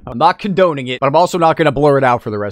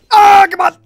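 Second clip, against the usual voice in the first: high, loud anguished yell; real scream of gamer frustration; frustrated scream; 9 dB louder than usual. Real, not acted. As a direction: wail it at the screen. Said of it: "This whole video has been me screaming".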